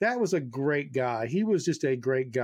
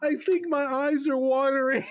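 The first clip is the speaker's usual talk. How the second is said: laughing retelling; 10 semitones higher, slower than usual. Retold or imitated. Dazed Dan, teller laughing.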